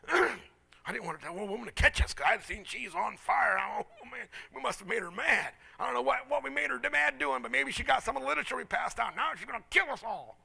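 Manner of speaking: hammed-up alarm, piling up fears; comic mock panic. Preacher recounting being chased by an angry woman, mock panicked.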